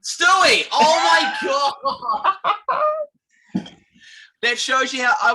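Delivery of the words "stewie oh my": high, loud exclamation; loud realisation. Real, not acted. Host hearing the correct name from guest, high excited shout. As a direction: shout it as the right answer hits you.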